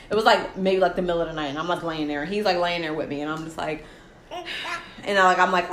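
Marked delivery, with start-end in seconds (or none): none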